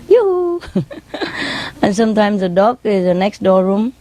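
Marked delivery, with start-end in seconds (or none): 0.1-0.6 high, drawn-out greeting call; parrot calling hello. Retold or imitated. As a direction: hoot a high greeting as the bird. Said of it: "she say".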